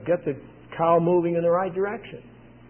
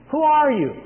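high, louder startled question; awed fear; 9 semitones higher, 5 dB louder than usual. Retold or imitated. Frightened Saul questions the voice, in mild fear and awe. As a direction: imitate an awestruck demand to know. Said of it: "filled with fear and terror and awe".

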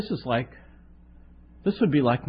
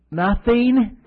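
louder scoffing nothing; 8 semitones higher, 6 dB louder than usual. Retold or imitated. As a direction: scoff at him. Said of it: "she looks at me with worse scoffing".

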